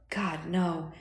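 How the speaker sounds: softly muttered, weakened reply; low mutter. A narrator's reading. Dying man told of a friend's death, muttering. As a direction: mutter it under your breath. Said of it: "Jericho muttered".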